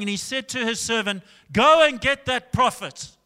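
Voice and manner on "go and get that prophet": loud, high angry command; raised-voice retold fury; angry king. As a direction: order the servant like an enraged king. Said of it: "he got so angry".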